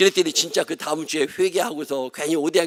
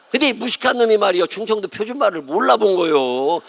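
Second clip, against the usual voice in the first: mock indignant; 5 semitones higher, 5 dB louder than usual. Real, not acted.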